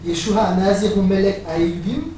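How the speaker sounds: "Yeshua Nazir Melech Ayudim" said slowly in Hebrew, rise on "Nazir"; Hebrew pronunciation. Shown as a demonstration. Preacher reading the sign's Hebrew to the congregation, slow careful pronunciation. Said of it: "excusez mon accent".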